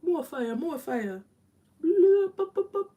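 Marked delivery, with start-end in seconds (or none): 1.8-2.9 1 playful bloop. Real, not acted. Playful sound while applying.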